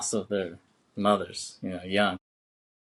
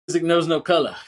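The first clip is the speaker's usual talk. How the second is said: loud, higher-pitched mock pronouncement; hammed-up celebrity interview voice; 6 semitones higher, 7 dB louder, faster than usual. Imitates [name] in interview.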